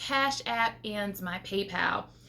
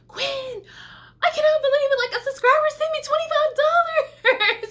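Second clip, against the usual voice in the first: high, loud, thrilled squeal to a friend; retold joy, pitched way up; excited; 7 dB louder than usual. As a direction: burst out with the good news. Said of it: "And I was so happy".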